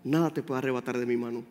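low, soft and tender reassurance; tender divine voice. Acted. God comforting a discouraged believer, softly and tenderly. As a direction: speak it like a loving father calming a frightened child.

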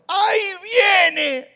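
long high scream; screaming mother in labor. Retold or imitated. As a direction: yell as the baby comes. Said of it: "las que gritaron".

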